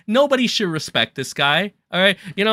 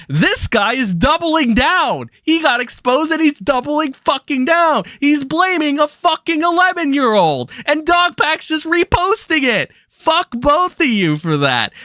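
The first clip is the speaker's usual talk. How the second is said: furious rant; 11 semitones higher than usual. Real, not acted.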